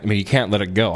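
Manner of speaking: usual speaking voice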